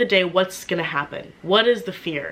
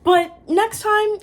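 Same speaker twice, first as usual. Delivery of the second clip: pointed request; 4 dB louder, much slower than usual. Retold or imitated. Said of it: "And then I was like".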